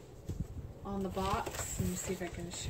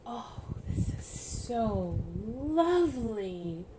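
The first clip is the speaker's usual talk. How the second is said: slow, drawn-out tender admiration; 3 semitones higher, much slower than usual. Real, not acted. Admiring the baby romper, slow and tender. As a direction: savour each word, slowly.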